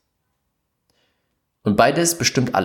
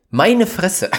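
much higher, incredulous blurt of disbelief; real exasperation at the absurd news; exasperated outburst; 9 semitones higher, 4 dB louder than usual. Real, not acted.

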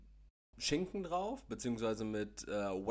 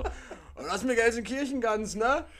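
higher, slow, loud mock farewell; mock Ruhrpott goodbye; 10 semitones higher, 6 dB louder, slower than usual. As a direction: drag out the brash goodbye.

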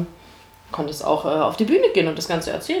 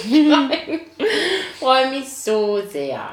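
laughing; 6 semitones higher, much slower than usual. Real, not acted. Excited about upcoming game.

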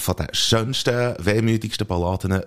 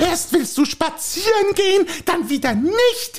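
a higher, fussy housewife voice pestering her husband; nagging wife. Acted. Wife nagging her husband to go for a walk, fussy. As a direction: play the nagging wife in a comic sketch.

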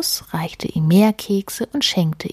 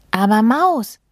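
higher, mildly raised exclamation; protesting call; 5 semitones higher, 4 dB louder than usual. Acted. Mole stopping mouse, mildly protesting call. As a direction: call out a worried objection. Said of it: "rief Maulwurf".

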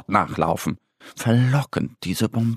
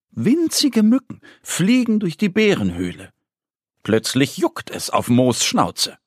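ordinary delivery

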